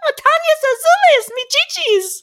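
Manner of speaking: high put-on singsong voice; mock Spanish voice. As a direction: ham up a silly Spanish voice. Mock singing Spanish phrase.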